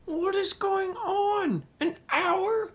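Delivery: very high frantic outburst; screaming. Acted. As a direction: scream in outrage. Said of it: "Screaming about the wait".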